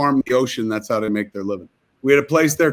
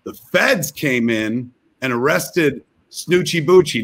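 normal talking voice

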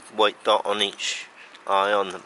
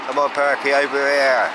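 loud, higher call across the garden; 6 semitones higher, 8 dB louder than usual. Real, not acted. Calling the pigeon Perky over to the food, loud call. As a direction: call the bird over to you.

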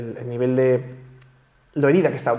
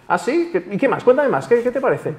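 much higher, bright and eager, fishing for more praise; 9 semitones higher than usual. Shown as a demonstration. Answering a compliment, asking for more, in a high eager voice. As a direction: act delighted and hungry for compliments.

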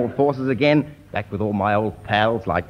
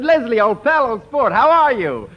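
high, loud gushing hello; thrilled greeting; 10 semitones higher, 5 dB louder than usual. Retold or imitated. Comic greeting an old pal, high loud gush. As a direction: greet him like a long-lost friend. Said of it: "I was so thrilled to see him".

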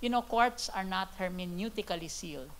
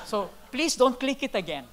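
laughing; 5 semitones higher, 5 dB louder than usual. Real, not acted. Joking plea to the audience.

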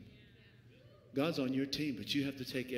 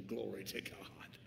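softer, breathy aside; murmured glory to God; whispered praise; 7 semitones lower, 10 dB quieter, slower than usual. Real, not acted. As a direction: drop to a hush.

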